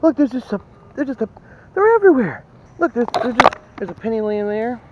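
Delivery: very high, squealing delight; tripping over words with glee; real excitement. Real, not acted. Detectorist spots coins everywhere, squealing with excitement. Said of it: "you know I'm excited".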